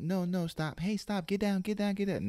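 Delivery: high-pitched, loud, ineffectual pet scolding; no bass, wrong way. Shown as a demonstration. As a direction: squeal hurried commands at a pet, with no authority. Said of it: "have to have bass in your voice".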